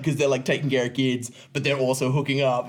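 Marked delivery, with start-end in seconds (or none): none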